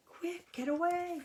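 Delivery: high, loud plea to flee; urgent call. Pretend. Teacher urging the storybook frog to flee, high call.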